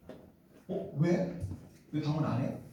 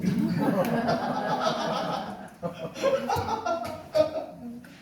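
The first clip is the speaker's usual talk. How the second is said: laugh; 6 semitones higher than usual. Real, not acted. Laughs after quoting visitor.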